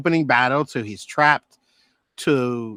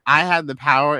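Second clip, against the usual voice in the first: mock heroic cry; 3 semitones higher, 6 dB louder than usual. Declaiming the He-Man line again.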